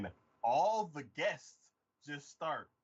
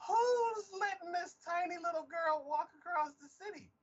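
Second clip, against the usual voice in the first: very high, exasperated disbelief; incredulous outburst; 3 dB louder than usual. Retold or imitated. Viewer aghast at child walking alone, high-pitched disbelief. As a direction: exclaim it high, utterly baffled.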